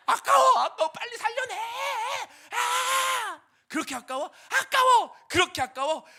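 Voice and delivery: very high, loud crying shout; childish wailing. Retold or imitated. Jonah wailing to God over his vine, crying shout. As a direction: bawl like a kid losing a toy.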